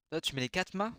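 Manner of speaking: normal talking voice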